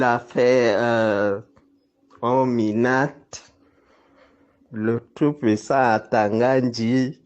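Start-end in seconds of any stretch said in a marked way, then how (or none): none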